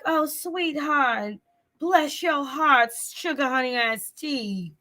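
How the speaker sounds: high, drawn-out Southern belle drawl. Pretend. Reader showing her Southern Belle moments, slow Southern belle accent. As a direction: stretch it out like a mock Southern belle. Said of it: "My Southern Belle moments".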